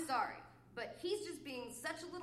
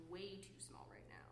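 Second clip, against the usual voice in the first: near-whisper, much softer and lower; hushed; 6 semitones lower, 11 dB quieter than usual. Acted. Complaining that he is being too small, hushed. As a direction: drop to a breath of a voice. Hushed, then repeated in normal voice.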